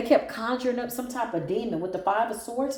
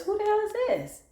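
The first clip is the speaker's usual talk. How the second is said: surprised; 13 semitones higher than usual. Real, not acted. Shocked at the card spread.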